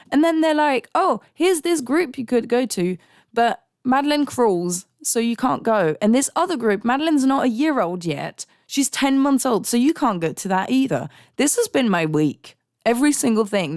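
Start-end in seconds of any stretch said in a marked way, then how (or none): 0.9-11.1 high, mocking bureaucrat voice; mock chirpy official. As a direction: parody the cheerful refusal. Mimicking chirpy services.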